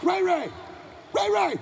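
high-pitched calling yell; Big Ray yelling. Retold or imitated. Father calls his son to the door, yelling. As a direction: call the kid from another room, pitched high. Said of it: "That's how he yells".

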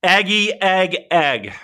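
high, loud rooster call parody; mock rooster crow. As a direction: crow it out big to make fun of it. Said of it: "That is the worst".

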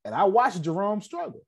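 high, building testimony; fervent high-pitched appeal; rising passion. Real, not acted. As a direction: preach it up, voice climbing.

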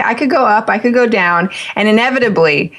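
ordinary talk

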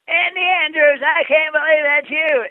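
high, loud, hearty call across a room; high-pitched exuberant Zig Ziglar impersonation; Zig Ziglar impression. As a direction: boom out an excited greeting in his voice. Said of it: "That was a good impersonation".

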